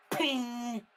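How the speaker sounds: high, loud ping of steel; sharp metallic ping noise; sword clang effect. Acted. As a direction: ring it out like metal on metal.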